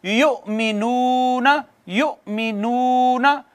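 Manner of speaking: slow, drawn-out chanted recitation; Quran recitation. Shown as a demonstration. Teacher reciting the verse for students, slow chanted recitation. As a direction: recite each word slowly, stretching the vowels. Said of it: "teacher recites for students".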